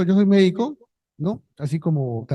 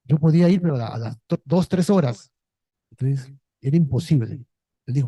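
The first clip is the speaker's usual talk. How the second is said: normal talking voice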